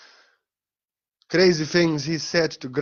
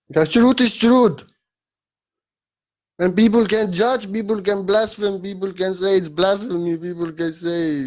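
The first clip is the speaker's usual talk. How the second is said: raised, higher voice, hammering each point; fervent preaching; 7 semitones higher, 5 dB louder than usual. Acted. Speaker preaching to listeners that people can judge, fervent preaching. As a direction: preach it to the crowd.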